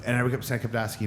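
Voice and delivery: ordinary delivery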